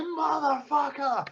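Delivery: slow, high, drawn-out swearing; seething curse. Retold or imitated. Hit in the nose again, drawn-out angry curse. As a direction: stretch every syllable in retold outrage.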